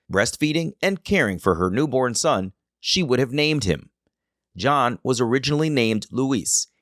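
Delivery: steady storytelling narrator voice; documentary narration. Acted.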